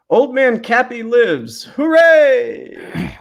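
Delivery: put-on high, slow proclamation of an old man; hammed-up read. Reading a donor's message aloud, high and hammy. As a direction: cheer it out like a town crier.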